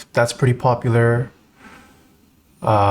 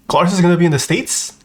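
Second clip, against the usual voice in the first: surprised; 6 semitones higher, faster than usual. Real, not acted. Surprised news of friend visiting.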